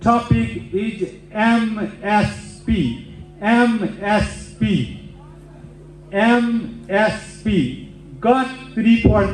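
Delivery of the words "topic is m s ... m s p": M S P, each letter slow and separate; spelling out the topic like a lecturer; slow spelled out. Shown as a demonstration. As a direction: spell M S P out slowly for the class. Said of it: "So the topic is there".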